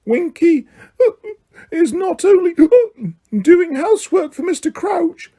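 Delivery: high, indignant weepy elf voice; Winky defending herself indignantly to Hermione; indignant squeak. A narrator's reading. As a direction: squeak back in drunken indignation. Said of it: "Winky squeaked indignantly".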